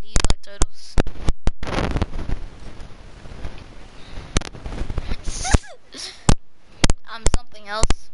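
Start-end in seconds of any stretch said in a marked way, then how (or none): 1.0-7.0 long high wheezy laugh; breathless laugh. Real, not acted. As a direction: lose it laughing.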